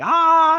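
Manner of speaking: short, very high, loud battle cry; mock battle yell. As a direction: let out a hammed-up power-up yell. He's like, second wind.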